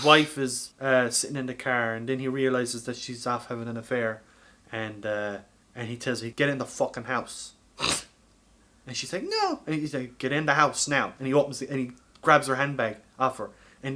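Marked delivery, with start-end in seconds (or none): none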